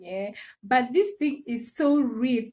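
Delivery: ordinary talk, nothing marked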